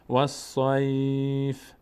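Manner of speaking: "و صیف" very slow, long "sai" and bare f; vowelless final f. Shown as a demonstration. Teacher demonstrating the pause form, drawn-out vowelless ending. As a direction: hold "و صیف" long, adding no vowel after f. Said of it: "کسره و فتحه و ضمه بهش نمیدیم".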